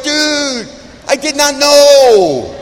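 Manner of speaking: high, loud, slow amazed puppy voice; excited puppy. Retold or imitated. Puppy amazed to poop outside, high and loud. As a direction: gasp it out like a thrilled pup. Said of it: "He'll be like".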